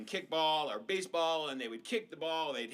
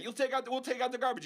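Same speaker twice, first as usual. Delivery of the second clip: put-on bargaining kid; 8 semitones higher, much faster than usual. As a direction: offer chores like a pushy kid. Kids bargaining.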